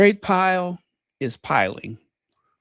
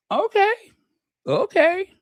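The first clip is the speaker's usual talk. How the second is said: surprised okay. Retold or imitated. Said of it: "And I was like".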